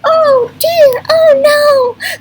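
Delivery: very high, loud panicky wail; hammed-up robot fright; scared robot. Scared robot character cries out.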